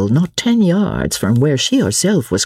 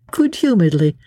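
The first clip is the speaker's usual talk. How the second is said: usual speaking voice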